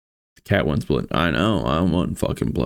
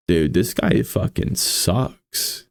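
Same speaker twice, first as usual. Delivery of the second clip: usual speaking voice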